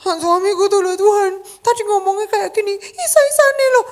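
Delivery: high, loud whiny gossip to God; mocking high whine; whiny complaining. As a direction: tattle like a petty wife. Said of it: "sama curhat gitu kan".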